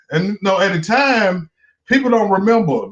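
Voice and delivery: usual speaking voice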